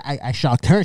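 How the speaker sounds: normal talking voice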